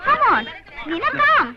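high, eager calling to friends; urgent call. Acted. Young woman calling her friends to follow her inside, high and eager. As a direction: hurry them along, calling out high.

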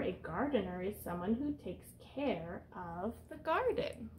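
ordinary delivery, nothing marked

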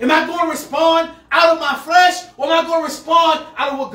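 preaching shout. Real, not acted. Pastor challenging the church loudly. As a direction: throw the challenge out.

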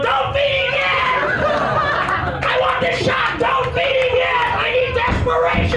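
high, shouted orders; hammed-up barking director; mock yelling director. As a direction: play the ruthless director. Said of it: "The director's going".

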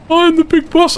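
high squeaky boast; mock big boss. Player mocking himself as the big boss, high and silly.

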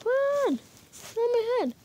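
high-pitched play-chase cries; play-acted urgency; pretend urgent calling. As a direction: call out high, as if fleeing.

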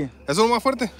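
high, loud disbelief; is that really it?; incredulous. Real, not acted. Mocking a weak lamp.

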